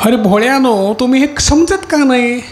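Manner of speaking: high, pleading call to the simple ones; impassioned plea. Acted. (भोळ्यांना उंच आवाजात कळकळीची हाक)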